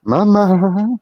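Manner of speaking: high called-out Mama; calling out. Real, not acted. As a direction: call across the house for Mama. Calling out to mum.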